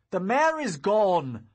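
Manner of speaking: high, loud, slow cry of anger; fuming. Acted. Young wizard raging that the mare was taken, loud angry cry. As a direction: let the loss burst out angrily. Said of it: "I fumed".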